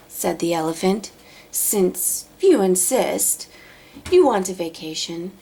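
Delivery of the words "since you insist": ordinary delivery, nothing marked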